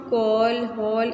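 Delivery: कॉल and हॉल with an open ऑ, slowly; open ऑ demo. Shown as a demonstration. Teacher reading English-borrowed words, slow open vowel. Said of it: "इस तरह से पढ़ते हैं".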